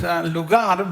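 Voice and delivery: ordinary talk, nothing marked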